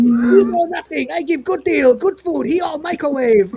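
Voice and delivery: put-on high-pitched caricatured Chinese accent. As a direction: dismiss a rival in a hammed-up Chinese-accented vendor voice. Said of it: "stereotype of a Chinese vendor".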